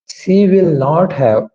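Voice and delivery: very slow, each word separated; slow dictation. Shown as a demonstration. Teacher slowly modelling the negative for students. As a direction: lay the words out one at a time. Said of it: "तो कैसे बोलेंगे इस sentence को?".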